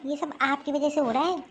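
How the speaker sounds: normal talking voice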